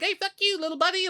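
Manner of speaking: very high cartoon squeak; tiny game character cursing in a high voice; rude little guy. Little game guy cursing at the player, high and cheeky.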